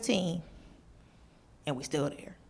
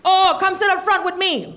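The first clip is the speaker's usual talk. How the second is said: higher, louder inviting uncle; friendly old driver; 7 semitones higher, 7 dB louder than usual. Retold or imitated. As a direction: beckon her warmly. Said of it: "driver invites her".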